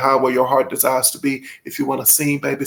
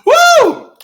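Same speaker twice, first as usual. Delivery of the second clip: high, loud woo; a big happy woo; excited whoop; 10 dB louder than usual. Real, not acted. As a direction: cheer out with joy. Excited cheer in vlog.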